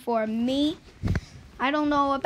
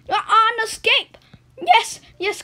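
higher, louder cheer; excited shout; 8 semitones higher, 9 dB louder than usual. Real, not acted. Gamer on the run, excited shout. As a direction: call it out thrilled.

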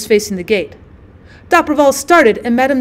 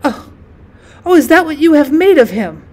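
louder, higher cry through sobs; sobbing reproach; 4 semitones higher, 4 dB louder than usual. A narrator's reading. Grieving mother reproaches the father outside the gate, sobbing. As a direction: accuse him as the sobs break through. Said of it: "she began to sob and said".

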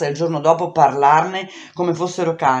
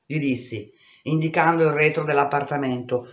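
ordinary delivery

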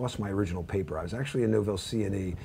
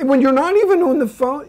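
much higher, anxious mom voice asking about phones; son's mother frets about cell phone spying, high and anxious; nervous mother; 11 dB louder than usual. Retold or imitated. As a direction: play the fretting mother, pitch way up. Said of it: "she gets so nervous".

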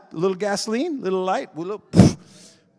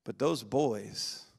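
ordinary talk

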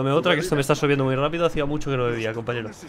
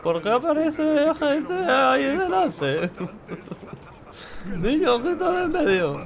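put-on much higher, slow drunken slur; hammed-up drunk voice, pitched way up; 10 semitones higher, slower than usual. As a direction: play a tipsy drunk asking the bartender. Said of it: "Esta es mi voz de borracho".

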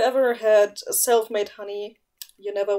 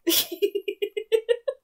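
high laugh; 10 semitones higher than usual. Real, not acted. Laugh at story.

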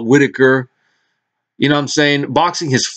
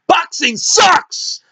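exasperated rant; 13 semitones higher than usual. Real, not acted. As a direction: sneer at the fans who keep falling for it. Ranting at boxing fans.

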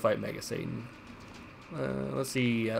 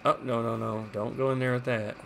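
ordinary delivery

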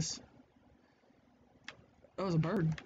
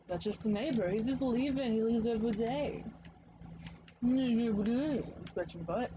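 soothing the dog; 6 semitones higher than usual. Real, not acted. Calming the puppy about the neighbor, sing-song reassurance. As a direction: coo at the puppy to calm her.

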